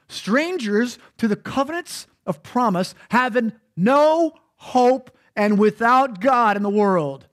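measured reading from the Bible; scripture reading. Acted. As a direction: read the verse deliberately. Reads scripture aloud.

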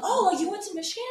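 high-pitched mock surprise; eager stranger. People coming up about the hoodie, imitated eager stranger. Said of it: "And they're like".

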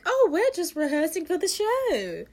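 high, falsely innocent chirp; innocent cover story. Retold or imitated. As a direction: sing it out like you've been caught. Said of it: "when they're trying to be like".

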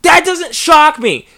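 high and loud, fed up; exasperated outburst. Real, not acted. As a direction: blurt it out, exasperated.

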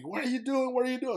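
very high-pitched mock scream; high, agitated retold shout without full volume. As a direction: imitate a frantic yell at the top of the range. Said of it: "starts screaming at me".

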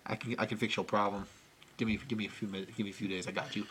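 low, very soft mobster promise; acted low-key reassurance; hushed assurance. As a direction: speak way down, quiet and low. Voicing Louie promising to fix it.

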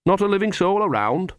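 high, cheery prying; bright. A narrator's reading. Nanny probing whether Mrs Gogol lives alone, brightly. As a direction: keep the question light and high. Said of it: "she said brightly".

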